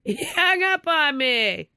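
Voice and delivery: high, drawn-out wail; anguished disbelief. Real, not acted. Telling how the newsman cut her off, anguished wail. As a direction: wail it slowly, close to crying. Upset at being hung up on.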